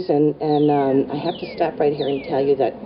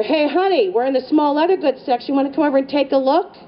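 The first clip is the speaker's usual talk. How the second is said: high, coaxing call to husband; singled-out, pitched-up invitation; calling over shoulder; 7 semitones higher than usual. Retold or imitated. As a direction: call across the aisle to lure him over. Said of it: "over my shoulder".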